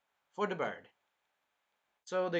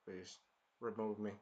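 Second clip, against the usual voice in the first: mumbled plea; 6 semitones lower, 10 dB quieter than usual. Retold or imitated. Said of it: "He was like".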